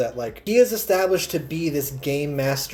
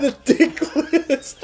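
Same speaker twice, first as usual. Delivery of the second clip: laughing; 12 semitones higher, much slower than usual. Real, not acted. Echoing joke while laughing.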